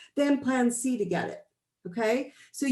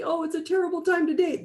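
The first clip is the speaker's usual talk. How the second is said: mock whiny complaint; 6 semitones higher, faster than usual. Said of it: "people are like".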